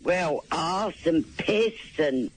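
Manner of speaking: ordinary delivery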